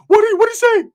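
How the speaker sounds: very high, loud cartoonish voice; squeaky mock outrage; outraged cousin. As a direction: imitate an indignant cousin demanding answers. Acting out primo's outraged question.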